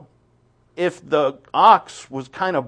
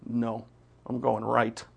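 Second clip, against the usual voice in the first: stubborn mutter; 3 semitones lower, 7 dB quieter than usual. Retold or imitated. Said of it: "you start kicking".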